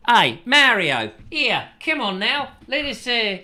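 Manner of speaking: put-on faltering Italian accent, pitched high; attempted Italian accent. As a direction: have a shaky go at an Italian accent. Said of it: "I can't get into an Italian accent".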